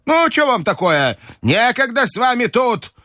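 put-on very high old-lady cry; high-pitched imperious calling out; shouting grandmother. A narrator's reading. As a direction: urge them on in a high, insistent voice. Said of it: "кричала бабушка".

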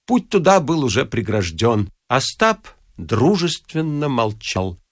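plain reading voice

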